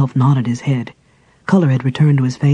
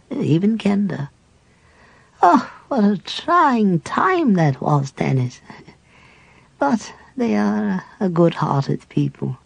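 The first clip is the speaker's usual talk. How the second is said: put-on slow, higher, tender old priest; old cleric at peace with dying; frail dying cleric; 3 semitones higher than usual. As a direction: reminisce gently facing death. Dying cleric speaks slowly.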